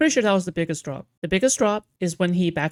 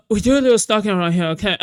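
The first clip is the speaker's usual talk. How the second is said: laughing, fast, louder mock Queeman voice; 3 semitones higher, 5 dB louder, faster than usual. Streamer laughs through his Queeman impression for chat: fast, loud, laughing. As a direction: squeeze it out like choking on a ghost stick. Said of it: "Queeman voice".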